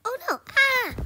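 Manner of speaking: high, loud panicked yelp; startled scream. Real, not acted. Player fails in the horror game, startled scream. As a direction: cry out, then scream.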